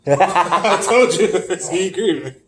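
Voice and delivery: slow words through laughter; laughing. Real, not acted. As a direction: laugh while you say it. Laughing after friend agrees.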